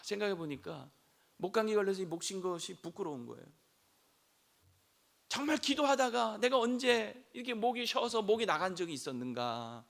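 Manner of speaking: usual speaking voice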